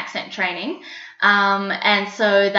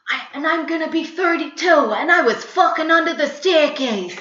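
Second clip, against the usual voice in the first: higher-pitched New York monologue voice; New York accent; 8 semitones higher than usual. Shown as a demonstration. Acting student performing a play's monologue, New York accent. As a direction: play it as a swinging, high New York character. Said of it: "learnt to do a New York accent".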